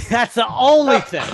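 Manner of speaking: loud, drawn-out words through laughter; high amused outburst; laughing. Real, not acted. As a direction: crack up while repeating the heckle. Laughs at listener's heckle.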